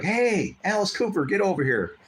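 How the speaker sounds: put-on girl calling him over. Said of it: "So she's like".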